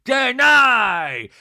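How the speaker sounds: loud, slow mock accusation; theatrical put-on scolding; Meredith impression. Mimics Meredith accusing Angie.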